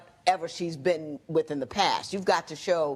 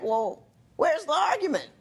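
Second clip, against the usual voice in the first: husband's puzzled quote; 8 semitones higher than usual. Retold or imitated. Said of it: "he goes, he's like".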